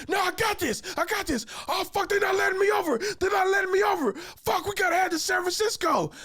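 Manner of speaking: high-pitched, wailing mock yell; panicked driver. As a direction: escalate from stubborn to frantic, all up high. Acts out driver panicking at missed exit.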